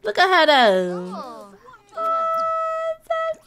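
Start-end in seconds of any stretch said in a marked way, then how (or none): 2.0-3.4 very high cooed oh so; cooing squeal. Real, not acted. Vlogger cooing over her Sim sister, squealing high. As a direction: coo like it's the cutest thing ever.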